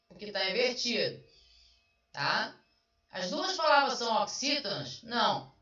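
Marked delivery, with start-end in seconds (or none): none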